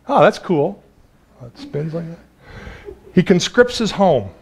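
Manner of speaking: ordinary talk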